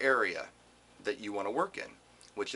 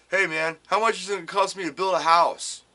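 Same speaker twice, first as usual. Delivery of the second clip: louder, higher, offhand guy on the phone; casual caller; 5 semitones higher, 7 dB louder than usual. Retold or imitated. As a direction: toss off the question like a clueless customer. Caller asks carpenter for price.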